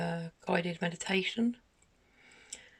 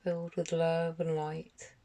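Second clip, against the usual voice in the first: guided meditation; 3 dB quieter than usual. Acted. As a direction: gently guide them through each breath, unhurried, letting phrases settle. Leads a guided meditation for children.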